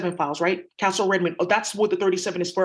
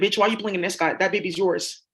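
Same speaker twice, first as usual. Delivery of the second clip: ordinary talk, nothing marked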